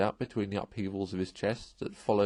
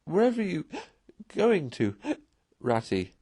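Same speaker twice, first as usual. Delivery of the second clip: high tearful cry with gasps; 7 semitones higher than usual. A narrator's reading. Tearful Mole crying out as Rat walks off, gasping. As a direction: call out in alarm, gasping. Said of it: "cried the tearful Mole".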